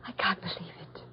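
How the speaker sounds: stunned whisper; whispering to Michael, stunned. Acted. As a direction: whisper it in shock. Shocked whisper to companion.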